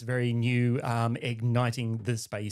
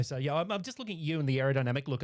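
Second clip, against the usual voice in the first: ordinary delivery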